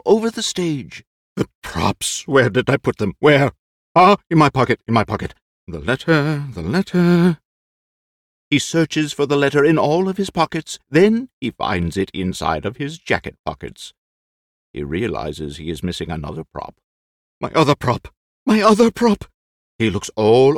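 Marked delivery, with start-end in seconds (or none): 1.4-7.4 high, loud, flustered; frantic old actor. Acted. Lolo searches for props. 17.4-19.3 high, loud, flustered; frantic old actor. Acted. Lolo searches frantically.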